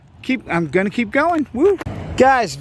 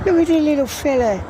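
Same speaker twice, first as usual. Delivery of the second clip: put-on sudden British accent; 6 semitones higher than usual. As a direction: coo over the wee magnet in a put-on British accent. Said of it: "I don't know why I'm British all of a sudden".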